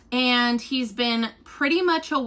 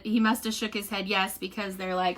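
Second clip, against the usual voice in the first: ordinary talk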